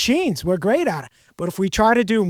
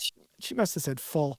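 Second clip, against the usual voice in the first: much softer, said to himself; muttered private realization; quiet inner thought; 5 semitones lower, 11 dB quieter than usual. Retold or imitated. Host recalls thinking it over, softly to himself. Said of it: "and I said".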